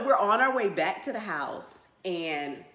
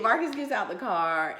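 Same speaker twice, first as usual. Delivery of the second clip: normal talking voice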